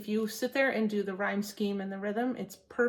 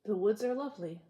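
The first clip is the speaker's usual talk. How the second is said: poem reading. Acted. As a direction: savour each line of the poem. Reads Frost poem to viewers.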